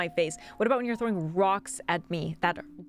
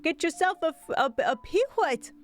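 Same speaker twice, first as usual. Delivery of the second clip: market hawker; 6 semitones higher than usual. Pretend. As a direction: call out the wares like a street vendor. Streamer hawks like a market seller.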